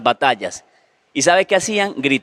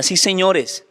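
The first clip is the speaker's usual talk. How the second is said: normal talking voice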